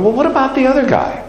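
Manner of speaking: put-on a young boy's baffled question; childhood self asking. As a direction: play the curious child in the pew. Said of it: "when I was a kid".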